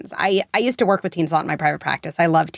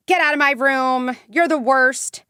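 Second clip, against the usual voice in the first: higher mock angry teen; teen telling off; 6 semitones higher, slower than usual. Teen shutting a parent out of her room, mock angry. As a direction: imitate a kid snapping at a parent.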